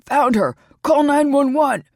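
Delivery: loud, high yell for help; man yelling for an ambulance while carrying her. Acted. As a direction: shout it to the whole crowd. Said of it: "all the while yelling".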